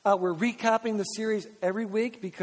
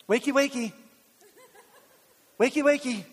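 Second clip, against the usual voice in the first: mock wake-up call; 5 semitones higher than usual. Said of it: "not a nap".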